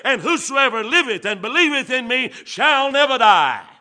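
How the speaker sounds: very high, loud, ringing cry; preaching at full force. Acted. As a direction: shout the promise to the rafters.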